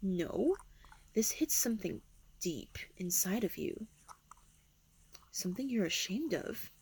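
soft breathy whisper. Acted. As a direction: whisper it close and teasing. Seductive whisper.

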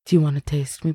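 ordinary delivery

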